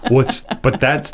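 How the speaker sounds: slow words through laughter; laughing. Real, not acted. Host reacting to the tack joke, laughing through words. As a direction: talk while still laughing.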